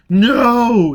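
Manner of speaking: high, loud, desperate no; desperate insistence. A narrator's reading. Foyle desperately denying he's marked for life. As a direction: refuse it with everything. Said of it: "he insisted desperately".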